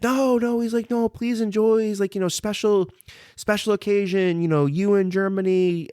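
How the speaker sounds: high-pitched imitation of an insistent host. Store owner urging a guest to enjoy the pour, high insistent imitation. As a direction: voice a generous host waving off protests, high.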